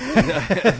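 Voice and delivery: sudden high burst of laughter; loud laugh. Real, not acted. Cohost cracks up mid-rant with a loud laugh. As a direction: let a big laugh burst out.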